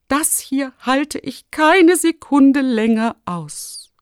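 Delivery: high, slow, drawn-out wailing; despairing lament. A narrator's reading. Exhausted rector wanting to quit his job, lamenting. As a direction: whine it out as if at the very end.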